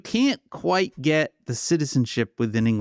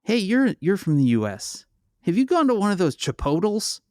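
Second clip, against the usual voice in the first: chirpy Canadian question, pitched up; Canadian colleague; 7 semitones higher than usual. Retold or imitated. A Canadian colleague in a taxi asking about Chipotle, high and eager. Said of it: "one of them turns to me and goes".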